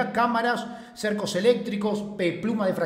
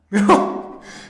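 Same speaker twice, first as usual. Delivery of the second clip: loud breathy laugh; burst of laughter; 7 dB louder than usual. Real, not acted. Streamer laughing at his own joke, loud. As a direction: let out a sudden loud laugh.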